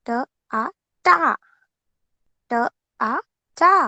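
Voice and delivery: ट, आ, टा said slowly; blending sounds aloud. Shown as a demonstration. Teacher drills class on टा, sounding out. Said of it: "ट को आ की मात्रा".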